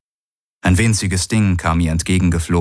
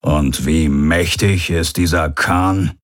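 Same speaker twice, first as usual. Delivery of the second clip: grim commander; 2 semitones lower, slower than usual. A narrator's reading. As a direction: ask it with slow menace. Commander growls, then asks grimly.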